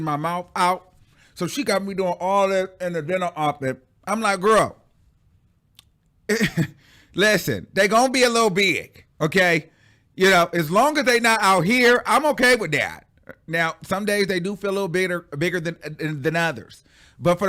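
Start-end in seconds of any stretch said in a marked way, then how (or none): 4.4-13.1 sassy reassurance. Retold or imitated. Telling stepmom teeth are fine.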